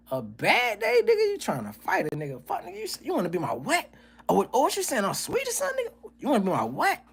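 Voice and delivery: high, jumpy, challenging voice; hammed-up aggressive street confrontation; mock fight-ready reply. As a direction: spit it out like you're ready to fight. Acting out a bad-day confrontation.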